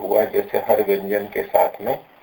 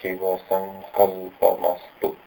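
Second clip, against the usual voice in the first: slow chant of तन्मे मनः शिव संकल्पमस्तु; slow mantra recitation; 2 semitones lower, 4 dB quieter, much slower than usual. Shown as a demonstration. Teacher chanting a mantra line for the student. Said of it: "उनकी अंतिम एक पंक्ति आती है".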